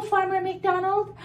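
ordinary delivery

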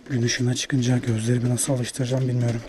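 ordinary delivery

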